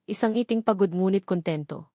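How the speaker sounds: ordinary delivery, nothing marked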